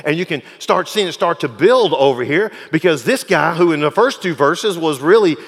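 loud, rising sermon voice; fiery pulpit preaching; preaching at full force. Acted. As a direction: preach it, building the fire.

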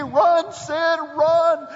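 loud, high yell urging the runner on; screaming at the TV. Retold or imitated. Fan screaming at the TV for Sid Bream, loud and high. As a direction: shout Sid home from the couch. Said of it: "I'm screaming at the T V".

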